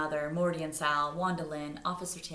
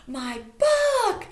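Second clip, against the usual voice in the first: very high, loud cry of joy; yelling with joy; 11 dB louder than usual. A narrator's reading. Stella yelling at finding her book, joyful. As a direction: burst out happily. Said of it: "Stella yelled".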